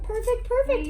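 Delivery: high praising coo. Real, not acted. Fitter praising the baby in the helmet.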